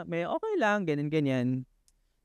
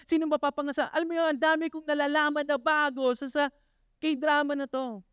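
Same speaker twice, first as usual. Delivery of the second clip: higher, building preacher's enthusiasm; rising excitement; 7 semitones higher than usual. Acted. Preacher telling the church, excited and lifted. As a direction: lift it up with joy for the series.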